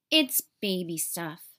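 mild mocking tease; teasing. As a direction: belittle her. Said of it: "teased Charlotte".

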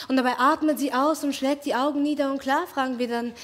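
usual speaking voice

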